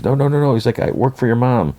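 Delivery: high-pitched hurried pleading, retold lightly; mock frantic protest. Costumed guy pleads with Marshall, mock panicked and high. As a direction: imitate someone fending off an attack. Attacked man explains himself hastily.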